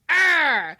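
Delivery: a sudden loud, high mock snarl of rage; snapping angrily at someone after flipping the switch; angry growl. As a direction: bark out a furious growl. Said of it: "we just flip that switch and we're like".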